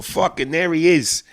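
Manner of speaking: effusive Italian-American neighborhood guy; old-school Italian-American barfly, hearty and familiar. Acted. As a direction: greet a celebrity like an Italian-American regular. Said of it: "old school Italian dudes".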